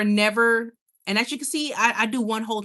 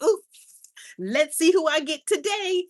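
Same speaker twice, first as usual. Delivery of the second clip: higher, playfully giddy anticipation; hammed-up "can't wait" excitement, pitched up; acted eagerness; 7 semitones higher, 4 dB louder than usual. Streamer acting out hitting the live button, giddily eager.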